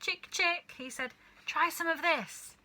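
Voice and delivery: high, coaxing call; coaxing a hen. A narrator's reading. George coaxing the brown hen, high coaxing call. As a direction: lure the hen gently.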